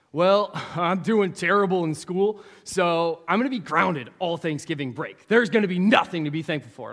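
voiced sulky student. Student's answer voiced aloud.

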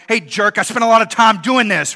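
hammed-up hurt snap at an ungrateful friend; mock indignant outburst. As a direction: pretend yelling at the gift receiver. Imagined inner retort to ungrateful friend.

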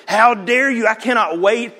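high, loud mock outrage; overlord ogre. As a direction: thunder it like a hammed-up vengeful overlord. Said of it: "this overlord ogre who's going".

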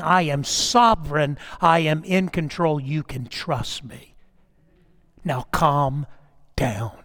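low, soft, steady voice of God; quiet, settling reassurance; calming reassurance. Acted. God calming a person like a child.

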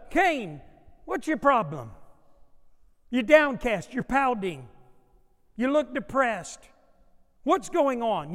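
high, loud voice of God scolding; God confronting Cain. Acted. God questioning sulking Cain, loud and high. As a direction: call him out, demand an answer.

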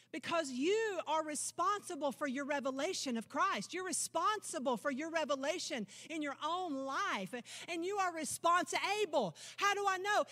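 normal talking voice

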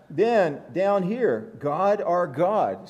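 "God our God" said slowly, each word weighed; emphasis on God. Shown as a demonstration. Preacher showing the congregation the psalm's emphasis, slow and deliberate. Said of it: "See the emphasis?".

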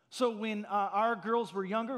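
usual speaking voice

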